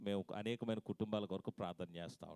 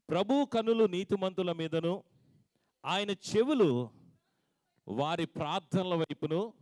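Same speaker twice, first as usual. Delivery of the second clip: loud, slow, high scripture proclamation; preaching at full force; 7 semitones higher, 9 dB louder, slower than usual. Acted. Pastor proclaiming the verse to the congregation, loud and slow. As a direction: ring out the verse to the church.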